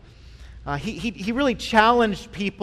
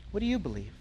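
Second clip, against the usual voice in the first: softer, pressing; 10 dB quieter, much faster than usual. Shown as a demonstration. Pastor presses the congregation, softer and intimate. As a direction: repeat it much softer and more intimate. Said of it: "asks the question twice".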